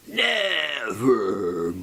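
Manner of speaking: a slow, lightly voiced raven croak; raven's reply. A narrator's reading. The raven answering the narrator, a slow, lightly voiced reply. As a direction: draw the word out like the bird. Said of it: "Quoth the raven".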